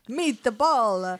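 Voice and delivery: comic mock Italian accent. Hamming it up for laughing co-host, Italian accent. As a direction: say it like a cartoon Italian. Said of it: "[name]'s doing her accents".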